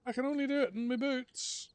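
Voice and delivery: schoolboy explaining to the teacher why he has no trainers; whiny younger self. Retold or imitated. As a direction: squeak it like a sulky schoolboy. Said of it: "And I say".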